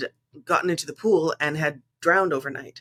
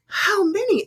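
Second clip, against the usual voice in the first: high, louder alarmed repeat; 4 dB louder, slower than usual. Retold or imitated. Guest pressing the pool cleaner about tarantulas, alarmed high ask. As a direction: push the question up in alarm.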